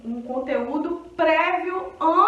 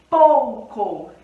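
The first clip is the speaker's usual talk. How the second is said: pouco said louder, O strong, U weak; modeled word; 2 semitones lower, 7 dB louder than usual. Shown as a demonstration. Teacher modeling a word for students. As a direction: say pouco clearly for the class to hear the glide. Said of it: "o O é uma vogal".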